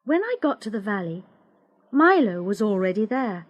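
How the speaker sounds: usual speaking voice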